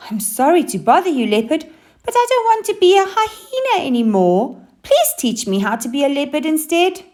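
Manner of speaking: lightly voiced high hyena, pleading; a hint of a pleading hyena. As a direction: ask the leopard eagerly. Hyena speaks to leopard.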